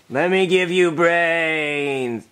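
very slow, drawn-out zombie drone; terrible zombie. Acted. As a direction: drone it like a badly faked zombie. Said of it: "you're a terrible zombie".

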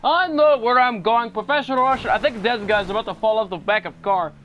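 a cocky Russian driver swearing he's a professional; Russian accent. Pretend. Reassuring his crashing passenger in a Russian accent. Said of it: "back to Russian accent".

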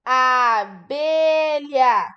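Abelha drawn out syllable by syllable; very slow dictation. Shown as a demonstration. Teacher dictating a word to children, very slowly. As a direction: stretch each syllable for the children. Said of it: "O que que tá faltando pra escrever abelha?".

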